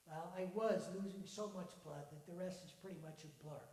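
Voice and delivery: usual speaking voice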